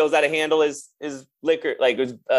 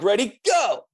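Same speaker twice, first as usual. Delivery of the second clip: higher-pitched start call, retold; race starter's call; 6 semitones higher, slower than usual. Storyteller re-enacting his start signal to racing bandmates, higher call. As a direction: call the runners off, drawing out the go.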